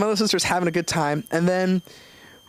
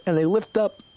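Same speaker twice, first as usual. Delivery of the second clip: ordinary talk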